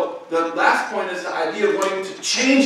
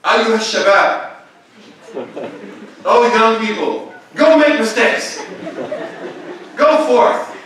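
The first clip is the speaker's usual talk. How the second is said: slow, louder, higher mock sermon; mock preacher; 6 semitones higher, 9 dB louder, slower than usual. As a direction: ham up a preacher addressing the youth. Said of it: "Did I stand up there and say".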